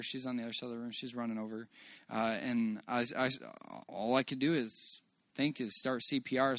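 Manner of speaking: normal talking voice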